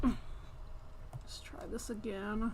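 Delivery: slow, drawn-out whisper; weary whisper. Real, not acted. Frustrated streamer whispering to herself after the cat interrupts. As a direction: breathe it out, worn down.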